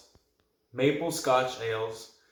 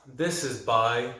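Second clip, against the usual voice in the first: usual speaking voice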